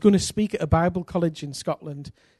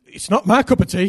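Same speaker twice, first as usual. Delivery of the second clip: raised, louder mock distaste; put-off landlord; 3 semitones higher, 6 dB louder than usual. Landlord telling guests about the church next door, put off. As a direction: imitate a man who wants no part of it.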